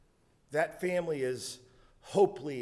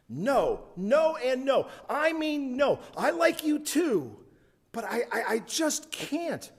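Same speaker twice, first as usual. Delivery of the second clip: put-on much higher, stammering girl's refusal; flustered girl; 11 semitones higher, 3 dB louder than usual. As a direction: stumble over the no in a high girl's voice.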